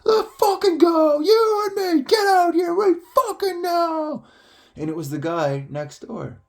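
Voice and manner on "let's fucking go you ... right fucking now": high, loud screamed tirade; neighbour's angry yell. Retold or imitated. Neighbour yelling at someone outside, furious shout. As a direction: imitate a furious man bellowing outside. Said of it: "then I hear this guy".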